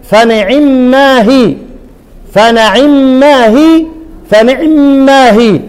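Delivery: three slow takes of فنعم ما هي, each with its own vowel on العين; the three readings. Shown as a demonstration. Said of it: "انتبهوا معايا الثلاث قراءات". (فنعم ما هي بالقراءات الثلاث تلاوة بطيئة)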